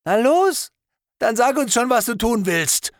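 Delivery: high, loud, near-breaking taunt; hysterical. Acted. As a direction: dare him, voice climbing high and loud. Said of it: "Es wurde jetzt ein bisschen hysterisch". (hoch, laut, hysterisch herausfordernd)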